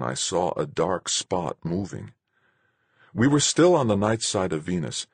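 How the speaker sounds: ordinary delivery, nothing marked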